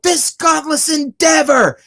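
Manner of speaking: high, drawn-out fan yell; retold yell. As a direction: re-enact yelling a song title from the crowd. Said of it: "And I yelled".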